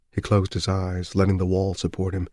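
plain reading voice

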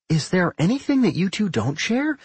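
high-pitched, inquisitive question; high voice, rising with interest; curious. A narrator's reading. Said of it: "Millie asked curiously".

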